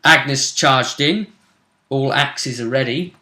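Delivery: normal talking voice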